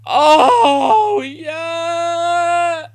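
very high, loud scream of joy; ecstatic scream. Real, not acted. Fan celebrating a goal with a high, loud scream. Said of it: "Oh, he's crying".

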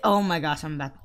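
usual speaking voice

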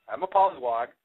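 ordinary delivery

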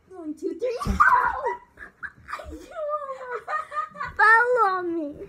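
high laughing yelp mid-fall; tripping and falling. Real, not acted. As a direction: yelp as she nearly falls on you. Partner almost falls on her.

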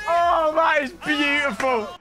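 delighted. Real, not acted. Admiring the replay.

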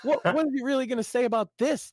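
ordinary talk

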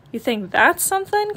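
one-up your sister; cried out. Acted. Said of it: "cried Brother".

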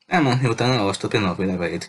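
ordinary delivery

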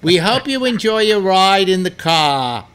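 high, loud mock New York woman; thick New York accent. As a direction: imitate a New York cab announcement lady. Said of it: "she had too much of an accent".

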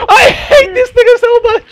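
high laugh-cry of frustration; laughing despair. Real, not acted. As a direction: cackle it out half-crying. Laughing rage at teammate.

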